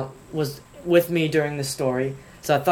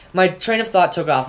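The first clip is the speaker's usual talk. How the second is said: usual speaking voice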